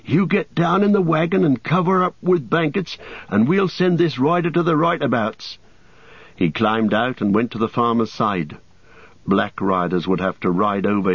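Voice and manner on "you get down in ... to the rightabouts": higher, protective servant voice; anxious Sam. A narrator's reading. Sam tells Frodo to hide, anxious. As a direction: brave but uneasy. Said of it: "said Sam anxiously".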